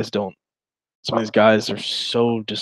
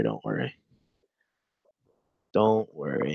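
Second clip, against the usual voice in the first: reassuring; 8 semitones lower, 6 dB quieter than usual. Acted. Soothing reply.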